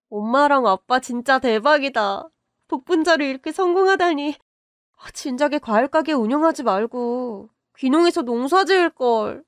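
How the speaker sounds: thrilled daughter cheering her parents' success; excited daughter. Acted. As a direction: burst out with joy at the good news.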